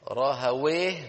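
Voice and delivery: "راهويه" slowly, with a clear open "a" on the waw. Shown as a demonstration. Teacher showing the grammarians' way, slowly. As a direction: say "راهويه" with the waw opened, drawn out. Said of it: "إما بفتح الواو".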